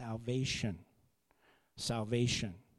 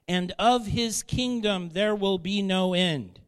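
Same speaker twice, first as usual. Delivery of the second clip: raised, measured reading of the angel's words; scripture reading; 4 semitones higher, 5 dB louder than usual. Acted. Preacher reading the angel's message to Miriam, proclaiming.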